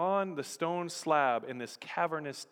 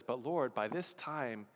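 Martha's quiet reply; 6 semitones lower, 10 dB quieter than usual. Retold or imitated. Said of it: "I love Martha's response".

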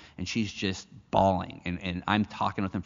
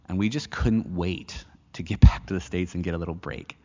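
laughing whisper; 2 semitones lower, 5 dB louder than usual. Real, not acted. Laughing at their eagerness.

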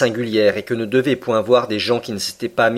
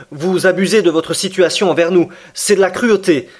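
high, louder indignant outburst; carried away; 9 semitones higher, 5 dB louder than usual. Acted. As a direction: lose your temper at the captain. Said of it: "emporté malgré moi". (Aronnax s'emporte contre le commandant, voix haute)

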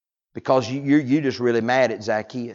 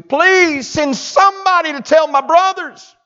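pleading rich man; 10 dB louder than usual. Acted.

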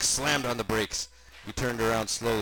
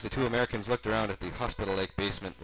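ordinary delivery, nothing marked

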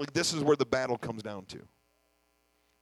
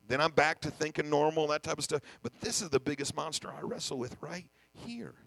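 ordinary talk

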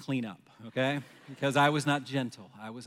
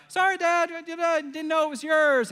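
very high-pitched imitation of children; excited kids; 14 semitones higher, slower than usual. Kids apologize to dad for taking the duck, in high excited voices. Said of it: "all excited".

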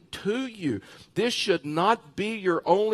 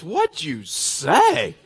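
drawn-out, loud, higher-pitched question; mock astonishment, slow and loud; imitating amused disbelief; 6 semitones higher, 6 dB louder, much slower than usual. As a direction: stretch it out as the brother's incredulous question. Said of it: "he starts dying laughing".

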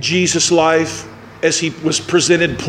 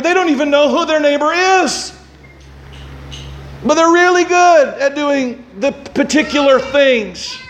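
preaching at full force; 9 semitones higher than usual. Acted. Preacher pressing the congregation, raised and fervent.

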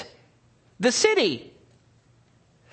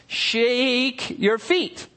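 "shake your feet" drawn out slowly; slowed for effect; 4 semitones higher, much slower than usual. Shown as a demonstration. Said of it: "never read a book that says".

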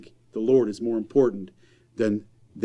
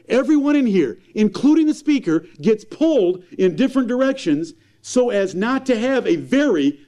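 raised, high-pitched pulpit preaching; fiery preaching; 8 semitones higher, 3 dB louder than usual. Acted. Preacher scolding parents' priorities, impassioned and raised. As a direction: preach it hard to the congregation.

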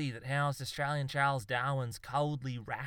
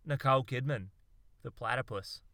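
ordinary delivery

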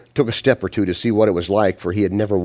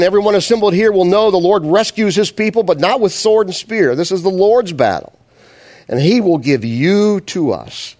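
much higher, loud scripture proclamation; preaching at full force; 10 semitones higher, 5 dB louder than usual. Acted. Preacher reading David's battle cry aloud, full-force preaching. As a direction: proclaim each phrase to the whole crowd.

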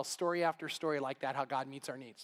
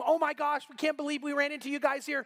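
excited yell; 6 semitones higher than usual. Retold or imitated. Retired couple greet church friends at gas station, excitedly calling. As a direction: imitate delighted friends calling out, pitched up. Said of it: "they yelled to him".

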